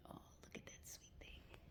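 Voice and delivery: soft unvoiced whisper; gentle whispered aside. Real, not acted. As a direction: hush it like a secret. Whispering sign-off.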